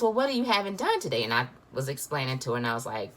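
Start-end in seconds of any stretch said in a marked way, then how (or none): 0.0-1.2 high, quick Southern sweetness; sweet Southern voice. Retold or imitated. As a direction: ask it like a sweet Southern nurse. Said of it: "in her sweet little Southern voice".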